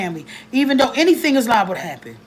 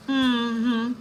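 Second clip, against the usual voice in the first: usual speaking voice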